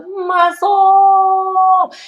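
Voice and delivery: drawn out, swooping high and loud; hammed-up hype. Vlogger bragging up her huge trainer, hammed-up hype. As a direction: stretch it big, like an announcer hyping a giant.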